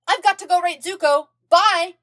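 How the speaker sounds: high, loud rushed goodbye; hurried exit. A narrator's reading. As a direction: dash off shouting bye. Aang hurrying off.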